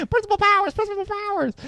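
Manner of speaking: very high falsetto cry for help; hammed-up high-pitched calling; mock panicked tattling. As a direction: squeal it like a frantic tattletale. Imitating man running for the principal.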